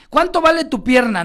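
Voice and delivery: shouted question from a stranger; loud challenging question. Retold or imitated. Stranger loudly asks what his leg is worth. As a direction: fire it out loud and high like a nosy stranger.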